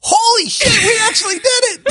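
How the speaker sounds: loud, high, triumphant shout; excited shout. Real, not acted. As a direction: yell it like you finally won. Finally finishing the outro take.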